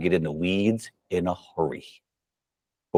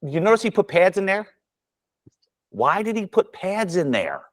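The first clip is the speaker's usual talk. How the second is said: pointed question; 9 semitones higher than usual. Retold or imitated. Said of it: "And I go".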